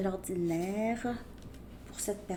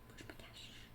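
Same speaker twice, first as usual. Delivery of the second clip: breathy whisper, much softer, voice dropped out; 22 dB quieter, faster than usual. Pretend. Reader voicing the person's hidden thought, in a whisper. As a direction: hush it to yourself like a secret. Repeats the inner question, whispered.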